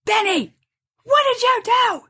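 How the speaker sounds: mother yelling at son over wasted supply; angry yell. Retold or imitated. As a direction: lose it at your son. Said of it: "And I don't usually yell".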